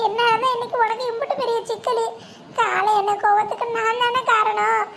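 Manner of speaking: weeping, begging forgiveness; crying apology. Acted. Woman crying as she begs her friend Uma to forgive her. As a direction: sob through the plea. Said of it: "அழுதுகிட்டே கிடக்கா".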